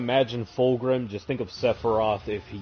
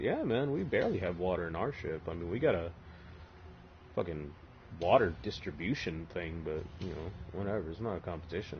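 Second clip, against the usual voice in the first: softer, lower grumble; 5 semitones lower, 10 dB quieter, faster than usual. Retold or imitated. Horus downplaying his ship's water.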